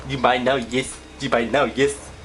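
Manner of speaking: lightly put-on accented salesman catchphrase; playful imitation, breaking into laughter; Angry Joe impression. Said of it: "Do the Angry Joe thing".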